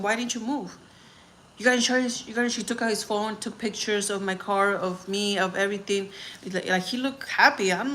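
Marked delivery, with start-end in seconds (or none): 0.0-2.6 retold insistent asking. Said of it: "I kept asking".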